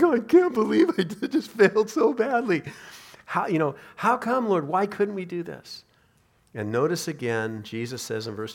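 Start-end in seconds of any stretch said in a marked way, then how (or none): 0.0-2.6 high, loud, laugh-choked wail; laughing mock dismay. Preacher recalling a ministry failure, laughing mock dismay. 3.2-5.8 high-pitched protesting question; baffled plea. Acted. Failed servant asking the Lord why, baffled plea.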